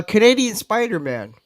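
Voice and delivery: usual speaking voice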